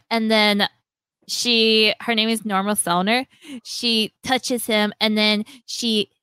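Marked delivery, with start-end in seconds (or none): none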